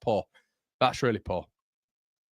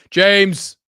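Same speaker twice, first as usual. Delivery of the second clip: loud, higher cry of a name; excited shout; 8 semitones higher, 11 dB louder than usual. Real, not acted. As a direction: shout the name as the shot goes in. Shot saved, exclaiming.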